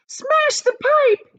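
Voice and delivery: high, loud, slow yelled command; yelling. A narrator's reading. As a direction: bellow it in desperation. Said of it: "yelled Missus Gloop".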